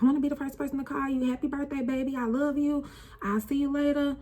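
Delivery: high, quick, loving mom voice; fast high imitation of mom on the phone; mom's birthday call. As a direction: sing out the birthday wishes like mom did at midnight. Voicing late mom's birthday call.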